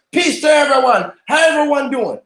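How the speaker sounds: walking into a restaurant, greeting the room loudly. Retold or imitated. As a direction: call out hello to everyone in the store. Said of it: "and say loud to everyone".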